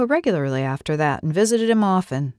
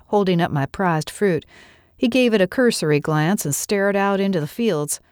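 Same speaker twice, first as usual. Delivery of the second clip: plain reading voice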